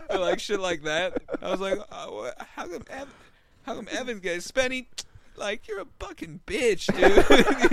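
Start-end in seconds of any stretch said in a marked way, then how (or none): 1.9-7.7 high, jokey indignant whine; teasing back at a drunk friend who skipped you; mock offended. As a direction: play hurt, then insult him back.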